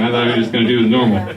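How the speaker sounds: normal talking voice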